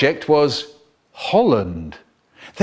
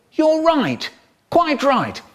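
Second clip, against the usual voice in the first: delighted inspector; 13 semitones higher, slower than usual. Acted. Inspector pleased with the answer.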